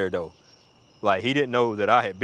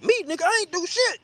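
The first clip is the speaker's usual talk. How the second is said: very high protesting squawk; hammed-up high defensiveness; indignant denial. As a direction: protest innocence in a pitched-up voice. Protests his innocence to friend.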